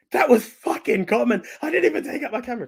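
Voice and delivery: high, loud, giddy exclamation; excited high-pitched outburst; starstruck disbelief. Retold or imitated. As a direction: retell it bursting with excitement, pitch climbing. Reliving amazement at meeting a star.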